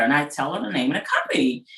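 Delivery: ordinary talk, nothing marked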